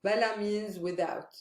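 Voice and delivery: ordinary talk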